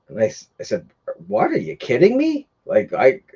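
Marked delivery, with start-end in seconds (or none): 1.3-2.5 shrill disbelieving yell at him; heated outburst. Retold or imitated. Retelling to viewers the neighbour asking for money, incredulous outraged yell. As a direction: throw his claim back in his face, incredulous. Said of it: "I got pretty heated".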